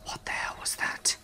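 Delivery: hushed, frightened whisper. A narrator's reading. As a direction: whisper it to the friend beside you. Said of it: "We whispered to each other".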